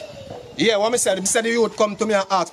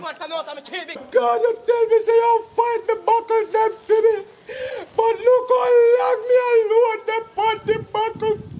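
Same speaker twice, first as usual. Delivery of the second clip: high, cracking cry to God; wailing prayer; 13 semitones higher, slower than usual. Acted. Stranded worker crying out to God for rescue, wailing. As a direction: wail it out like a man stranded and begging heaven.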